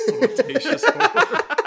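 crowing the silly new word at full volume; mock yell. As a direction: belt the phrase out, stretched way out.

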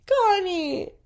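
high, loud call of a name. Real, not acted. Owner calling out to her dog Connie.

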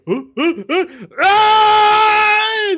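rising gasps bursting into a long loud high scream; gasp, gasp, then scream as you drop; acted shriek of a man falling; startled falling scream. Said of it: "And then all of a sudden you hear".

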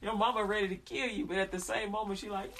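usual speaking voice

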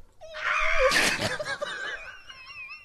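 high shrieking burst of laughter; cracking up at the podcast banter. Real, not acted. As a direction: scream with laughter. Cracking up at own line.

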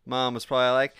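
usual speaking voice

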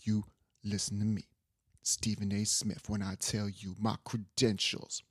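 lightly imitated low, slow pundit; a hint of a self-important sports commentator; Stephen A. Smith. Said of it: "He has a very low".

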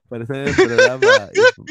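high, loud words through laughter; laughing correction. Real, not acted. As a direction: insist while cracking up. Se ríe de la broma al corregir. (hablando entre risas, agudo y fuerte)

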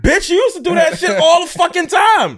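loud high-pitched retort; shouting. Retold or imitated. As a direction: yell it back in disbelief. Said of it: "I'm like".

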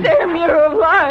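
high, loud, joyful outcry; overjoyed cry. Acted. Wife to husband back from the dead, overjoyed cry. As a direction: burst out with tearful joy.

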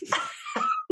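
squealing burst of laughter; a sudden high giggle. Real, not acted. Laughs at the story.

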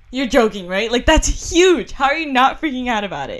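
loud, high, panicky and laughing; freaking out. Retold or imitated. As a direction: retell the moment you panicked over a huge spider. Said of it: "I was kinda freaking out".

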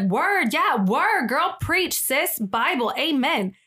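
high, emphatic amens in slang; hype-girl friend. Retold or imitated. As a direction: cheer her on like a preaching-along sidekick. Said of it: "And the other girl's like".

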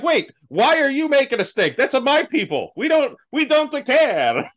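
high, hammed-up Italian; mock Italian accent. Italians answering the critics in hammed-up Italian. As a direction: lay on a comic Italian lilt. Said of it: "That's why it's like".

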